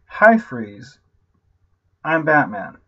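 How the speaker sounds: mock-serious Batman greeting; mocking Batman line. Shown as a demonstration. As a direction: say it again flat to show how dumb it is. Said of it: "That has got to be the dumbest".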